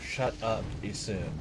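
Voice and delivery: low, very quiet grumble; real, spent, softly annoyed; deflated annoyance. Real, not acted. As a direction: mutter it under your breath, worn out. Muttered after the outburst.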